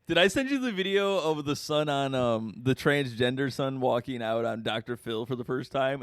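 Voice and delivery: ordinary talk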